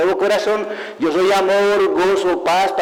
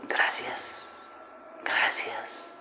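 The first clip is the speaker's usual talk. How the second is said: whispered thanks, much softer; 13 dB quieter, much slower than usual. Real, not acted. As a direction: murmur thanks under your breath.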